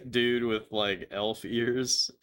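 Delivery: ordinary talk, nothing marked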